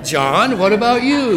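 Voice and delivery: high, loud hopeful appeal; comic pleading. Acted. Lame man asks John to heal him instead, comic high appeal. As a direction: call out high and loud to the other one.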